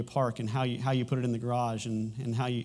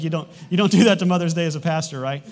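raised, louder scolding voice imitating the congregation; mock scolding; 4 semitones higher, 11 dB louder than usual. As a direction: scold him like offended churchgoers, loud and high. People scolding the pastor.

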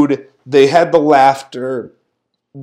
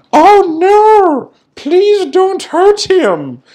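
high, wailing mock plea; mock-pleading referee; 14 semitones higher, 3 dB louder, much slower than usual. Referee begging for the monster's safety, mock-pleading. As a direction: draw out an exaggerated, high-pitched plea. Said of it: "the referee goes".

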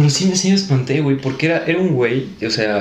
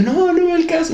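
wriggle out of it in a raised voice; acted reluctant protest. Son refusing mother's order to get out, mock pleading.

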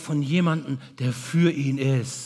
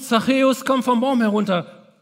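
higher and louder, calling up into the tree; 7 semitones higher, 4 dB louder than usual. Retold or imitated. Jesus calling up to Zachäus in the tree, loud call. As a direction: call him down from the branches. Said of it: "Jesus ruft".

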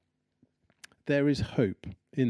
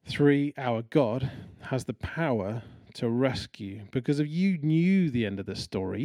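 usual speaking voice

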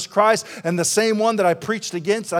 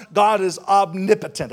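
ordinary talk

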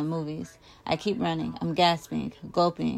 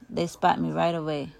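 usual speaking voice

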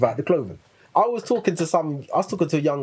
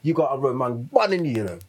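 ordinary talk, nothing marked

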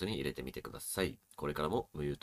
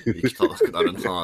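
laughing; 4 semitones higher, 11 dB louder than usual. Real, not acted. Laughing at a misheard phrase.

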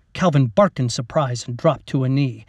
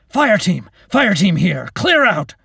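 loud, high urgent battle shout; shouted call; 6 semitones higher, 7 dB louder than usual. Acted. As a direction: bellow the order across the battlefield. Soldier calling during troll attack.

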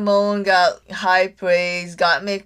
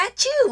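tiny high sneeze; squeaky little sneeze; cute sneeze. Retold or imitated. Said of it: "At least, like, the a".

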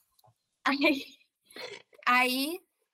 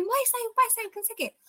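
retold panic, much higher pitch; pânico; 10 semitones higher than usual. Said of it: "simplesmente entrei em pânico". (pânico imitado, voz bem aguda)

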